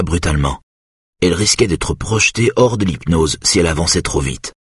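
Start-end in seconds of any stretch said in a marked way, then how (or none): none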